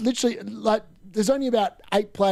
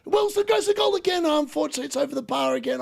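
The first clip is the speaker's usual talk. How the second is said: much higher, rising to the shot then dropping; excited commentary; 8 semitones higher than usual. Real, not acted. Fan calling Wilson's shot over the bar, excited. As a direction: call it live as it flies over.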